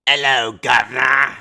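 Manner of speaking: loud mock British accent greeting; British cabbie accent; British taxi voice. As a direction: ham up a Cockney cabbie. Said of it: "The evil British taxi".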